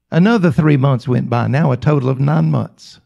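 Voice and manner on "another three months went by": ordinary talk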